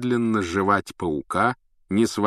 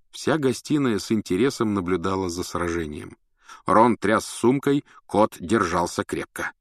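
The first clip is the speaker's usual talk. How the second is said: usual speaking voice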